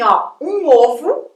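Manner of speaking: slow "ovo" with a closed o; "um ovo", closed o, for the lesson. Shown as a demonstration. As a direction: say "ovo" slowly, with the first o closed. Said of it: "quando eu falo assim".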